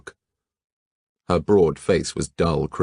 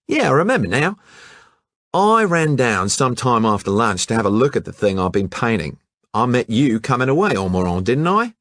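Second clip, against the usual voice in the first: much higher, louder brash Aussie; breezy colonial lad; Hatchett's Australian voice; 7 semitones higher, 5 dB louder than usual. Acted. Hatchett answering the detective casually, brash Aussie voice.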